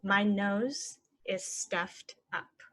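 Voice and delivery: very slow and even. Shown as a demonstration. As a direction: draw it out for learners. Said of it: "normal then slow".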